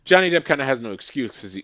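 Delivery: usual speaking voice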